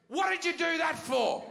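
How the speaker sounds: high-pitched mock scolding; high, indignant parental demand; scolding mother. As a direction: imitate an exasperated mother telling off her son.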